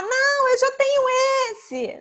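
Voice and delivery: put-on high, loud whiny kid protest; spontaneous child. Child turning down a present, loud high whine. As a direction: voice a blunt little kid complaining. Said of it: "Criança autêntica, espontânea, vai falar".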